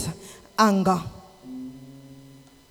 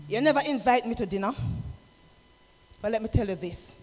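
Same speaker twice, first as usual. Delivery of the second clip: ordinary delivery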